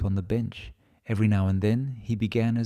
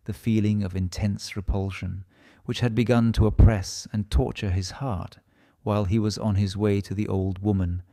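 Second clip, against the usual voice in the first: plain reading voice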